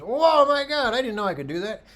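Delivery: high, loud burst of amazement; a student thrilled to discover his lens; amazed. Retold or imitated. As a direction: imitate the student's delight. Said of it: "he goes".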